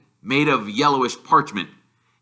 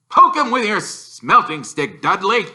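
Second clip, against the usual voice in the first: high, loud caricature uncle; Uncle Vernon urging; 9 semitones higher, 6 dB louder than usual. Acted. Uncle urging Dudley to poke Harry, high loud voice. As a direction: egg him on gleefully.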